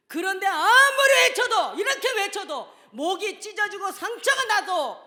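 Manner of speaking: high, loud, laughing exasperation; exasperated preacher shouting with a laugh; fervent crying out. Real, not acted. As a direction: cry out, half laughing in frustration. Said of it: "소리를 질러대도".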